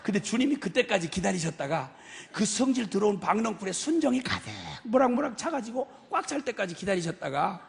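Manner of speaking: normal talking voice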